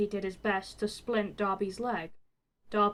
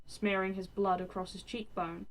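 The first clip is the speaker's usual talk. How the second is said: ordinary delivery, nothing marked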